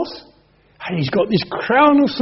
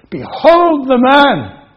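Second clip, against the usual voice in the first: raised, slow proclamation; proclaiming; 5 semitones higher, 7 dB louder, slower than usual. Acted. Pilate presents Jesus to the crowd, slow and loud. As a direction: announce it slowly to the crowd.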